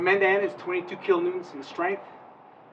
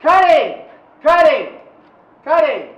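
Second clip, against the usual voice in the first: shouting; 8 semitones higher, 18 dB louder, slower than usual. Real, not acted. Calling out during drill.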